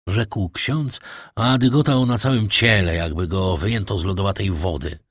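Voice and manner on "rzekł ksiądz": plain reading voice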